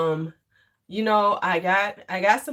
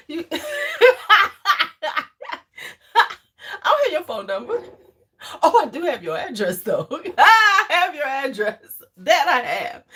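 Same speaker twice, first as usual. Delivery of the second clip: higher, talking through laughter; real laughter; 7 semitones higher, 5 dB louder than usual. Real, not acted. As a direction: crack up while teasing him. Cracking up while teasing D'Angelo.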